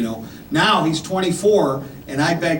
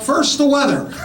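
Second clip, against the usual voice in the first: mock newscaster; 10 semitones higher than usual. Newscaster parody.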